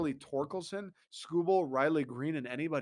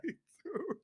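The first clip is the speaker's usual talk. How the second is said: ordinary delivery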